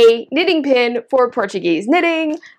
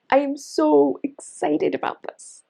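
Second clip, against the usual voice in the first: ordinary talk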